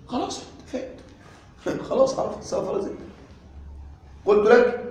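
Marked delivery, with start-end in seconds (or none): 0.1-3.0 whispered aside. Pretend. Teacher voices student who understood.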